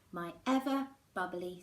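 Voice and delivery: plain reading voice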